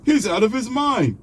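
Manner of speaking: high-pitched, hammed-up alarm; mock outraged viewer. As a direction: squeal it like a scandalized viewer. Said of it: "I know how some of you think".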